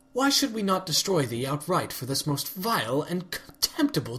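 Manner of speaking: royal goddess voice, archaic and accusing; a lightly voiced stern princess, cold and suspicious; regal accusing Celestia. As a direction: condemn an impostor from the throne.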